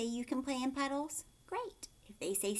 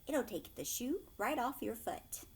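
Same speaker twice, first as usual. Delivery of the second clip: plain reading voice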